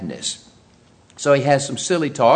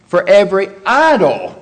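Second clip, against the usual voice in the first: "every idle" said louder, idle falling hard; stress on idle; 5 semitones higher, 6 dB louder than usual. Shown as a demonstration. Preacher explaining the verse, punching idle. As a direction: land on the word idle. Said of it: "that's an emphatic word".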